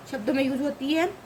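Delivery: usual speaking voice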